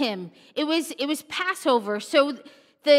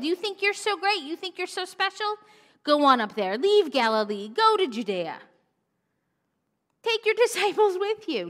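mocking siblings; 6 semitones higher than usual. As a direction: needle him like a jealous brother. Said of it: "his four brothers say".

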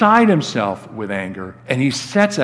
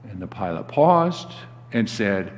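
ordinary delivery

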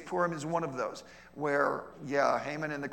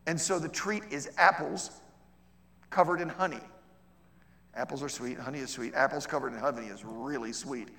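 ordinary talk, nothing marked

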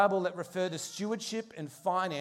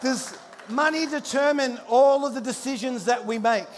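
loud question; 5 semitones higher, 6 dB louder than usual. Real, not acted. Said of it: "repeated question".

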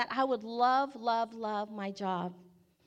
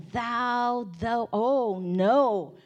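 mock recoil; 7 dB louder, much slower than usual. Balking at first Bible reading.

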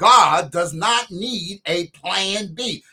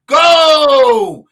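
shouting; 6 semitones higher, 9 dB louder than usual. Acted. Preacher urges church attendance.

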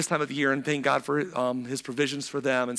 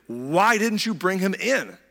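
drawn-out, higher-pitched demand; 5 semitones higher, 4 dB louder, slower than usual. Acted. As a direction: demand an answer, stretching the words. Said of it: "priests and Pharisees demanded".

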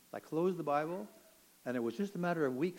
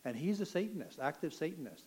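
ordinary talk

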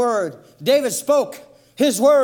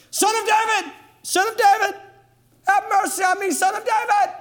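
high, pleading calls; high crying out; 8 semitones higher than usual. Acted. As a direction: cry out for mercy, high. Blind beggar cries out.

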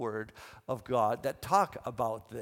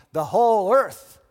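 loud, raised answering cry; calling out in response; 6 semitones higher, 14 dB louder than usual. Acted. Second group of angels answering the first, calling out. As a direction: proclaim it back, big and high. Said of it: "the other group is responding".

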